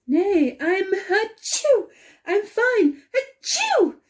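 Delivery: protesting between high sneezes; sneezing mid-sentence. Acted. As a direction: insist you're fine while sneezing. Sneezes through her denial.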